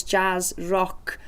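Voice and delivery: normal talking voice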